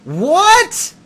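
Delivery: huge, high shocked roar. A narrator's reading. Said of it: "I roared so loudly".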